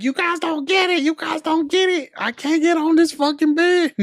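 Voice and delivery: high, whiny mock of a crying woman; imitating the stuck lady, high and pleading. Friend mocks a crying woman stuck off her bed, high whine. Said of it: "and she's like".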